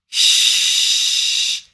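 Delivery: "Ch." as a long voiceless rush of air; airy ch. Shown as a demonstration. Teacher spreading the air on the ch sound. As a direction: push air out on "Ch.". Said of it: "je propage l'air".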